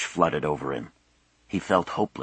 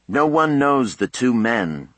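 raised, slow on-the-scene report; TV news reporter; 6 semitones higher, 4 dB louder than usual. A narrator's reading. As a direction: announce it like a field correspondent. Voices a TV reporter.

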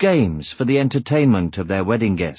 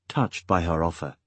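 ordinary delivery, nothing marked

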